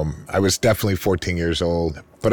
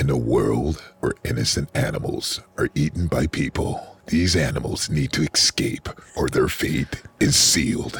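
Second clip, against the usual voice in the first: deep, low trailer narrator; movie trailer voice; 6 semitones lower than usual. Shown as a demonstration. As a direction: boom out an action movie intro. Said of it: "maybe say something".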